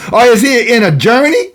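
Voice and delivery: high, taunting prophet's challenge; mock their god with lifted, teasing questions. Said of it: "Elijah mocked them, made fun of them".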